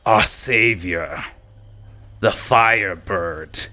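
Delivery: name the savior with reverence; Goblin King. Acted. Goblin King presenting the Firebird to goblins, reverent. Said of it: "Goblin King continues".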